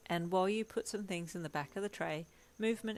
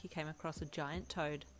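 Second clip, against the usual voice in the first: ordinary delivery, nothing marked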